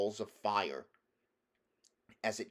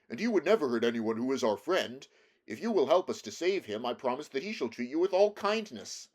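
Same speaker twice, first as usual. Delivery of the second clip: lightly voiced Scarecrow, louder than narration; earnest Scarecrow; 4 semitones higher, 6 dB louder than usual. A narrator's reading. Scarecrow assures the mouse queen about his friend, louder earnest voice. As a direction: make an earnest, projected promise.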